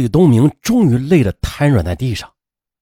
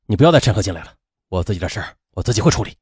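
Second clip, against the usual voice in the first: irritable; 5 semitones lower, 3 dB quieter, faster than usual. A narrator's reading. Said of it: "李东明没好气地说".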